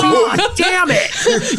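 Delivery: high, drawn-out mock-angry cry; hammed-up outraged howl; exasperated outburst. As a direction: wail it slowly, rudely woken. Acts out being hit awake.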